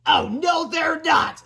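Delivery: put-on high, loud kid's objection; tattling boy's voice. A narrator's reading. School tattletale from the table's end, loudly objecting. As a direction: pipe up in a high, gleeful contradiction. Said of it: "said a voice from the other end".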